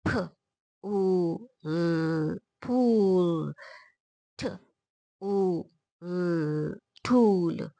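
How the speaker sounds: long held ऊ and ल, then blended 'फूल', 'ठूल'; sounding out. Shown as a demonstration. Teacher drilling long-vowel words, sounds drawn out. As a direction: puff the first sound, stretch the vowel, blend. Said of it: "चलिए बोलते हैं".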